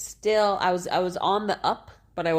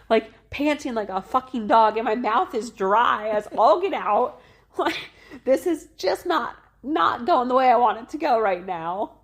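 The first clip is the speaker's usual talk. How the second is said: much higher, louder furious rant; mad inner rant; 7 semitones higher, 5 dB louder than usual. Retold or imitated. Mother recalling her panic after birth, high furious rant. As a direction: vent furiously at your own shaking body. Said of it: "I was so mad in that moment".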